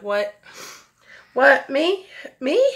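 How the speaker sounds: stunned disbelief. Retold or imitated. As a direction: replay the moment of not believing it. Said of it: "And I'm like".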